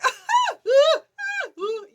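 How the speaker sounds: loud, high screaming whoops; high-pitched repeated yelps of fright; re-enacted screaming. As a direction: shriek over and over as if panicked. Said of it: "I'm screaming the whole time".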